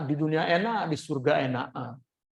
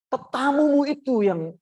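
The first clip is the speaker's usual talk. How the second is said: high, louder, slow protest; annoyed retort; 7 semitones higher, 5 dB louder, slower than usual. Retold or imitated. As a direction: drag out "tetamumu" in protest. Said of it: "wah, dia marah pula".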